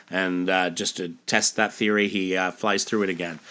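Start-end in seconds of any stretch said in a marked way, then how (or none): none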